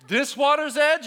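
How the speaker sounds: high, loud defiant mock question; taunting shout. Students up to here in water, loud taunting. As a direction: call it out high and cheeky, goading. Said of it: "they were like".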